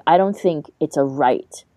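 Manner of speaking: normal talking voice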